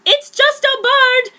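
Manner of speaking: high, loud, slow yell; Ron yelling. Acted. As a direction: bark it angrily. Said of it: "yelled Ron".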